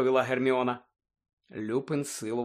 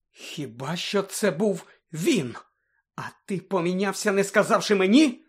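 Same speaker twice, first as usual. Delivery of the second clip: dawning realisation; 6 semitones higher, 3 dB louder than usual. A narrator's reading. Lupin suddenly realises the truth.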